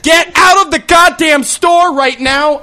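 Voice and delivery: high, loud, slow yell. Retold or imitated. Chaperone yelling at kids in the supermarket, loud and high. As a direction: bellow at the kids to leave. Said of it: "I start cursing and yelling".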